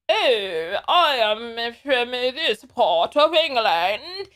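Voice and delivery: slow, drawn-out mock posh British accent; posh English accent. Host imitating privileged UK speakers, mock posh English accent. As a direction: put on a loud, lingering upper-class English accent. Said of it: "the people who speak like that".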